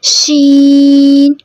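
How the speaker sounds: शीन with the sh hissed and held very long; drawn-out sheen. Shown as a demonstration. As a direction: stretch शीन, holding the sh. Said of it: "हम practice करते हैं इसकी".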